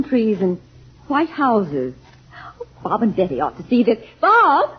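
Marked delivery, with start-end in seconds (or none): 4.2-4.7 calling out. Acted. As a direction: call out across the dunes. Calling out to son.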